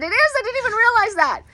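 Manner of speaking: high, loud mock surprise; retold amazement, voice jumping high; surprised. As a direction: act out the surprised reply. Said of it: "she goes ... And I said".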